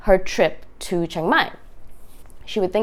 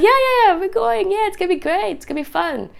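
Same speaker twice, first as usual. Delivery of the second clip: breezy friend voice; 12 semitones higher than usual. Retold or imitated. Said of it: "they're like".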